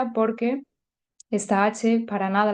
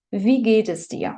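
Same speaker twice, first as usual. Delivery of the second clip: slow clear demo; slower than usual. Shown as a demonstration. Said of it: "Vamos poco a poco aquí también".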